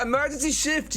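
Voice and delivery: very high, feigned astonishment; hammed-up high-pitched delight; mock surprise. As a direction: act amazed at their pick. Feigns surprise at chat's choice.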